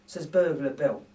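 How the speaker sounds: ordinary delivery, nothing marked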